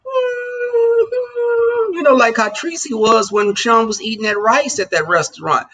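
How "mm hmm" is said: high pleading hum like a begging puppy; imitated puppy whine. Streamer imitating her puppy guilting her, high whine. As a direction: whimper it the way a puppy begs. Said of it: "they'll start whining and they'll be like".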